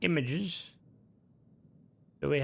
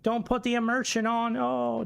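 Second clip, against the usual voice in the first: put-on high, sing-song stage Irish accent; hammed-up Irish brogue, pitched high; 9 semitones higher than usual. Streamer mocking wannabe Irish who play into it, put-on Irish accent. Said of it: "kinda play into it, like".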